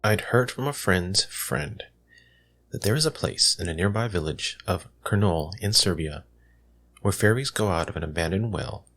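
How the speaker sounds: usual speaking voice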